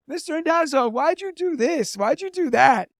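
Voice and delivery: high mock student voice; imitating students. Students quizzing teacher, retold.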